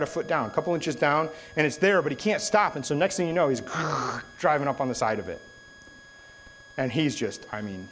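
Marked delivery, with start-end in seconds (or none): 3.7-4.3 throaty crunch of a hull on concrete; imitated scraping noise. Storyteller mimics brother's boat hitting the pylon, scraping noise. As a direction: mimic a boat grinding to a halt.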